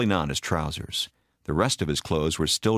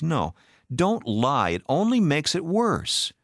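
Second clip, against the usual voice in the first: high, heated rebuke; upset scolding that climbs high; angry interruption; 9 semitones higher, slower than usual. A narrator's reading. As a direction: cut off the excuses, voice rising. Wife cuts off husband's excuses.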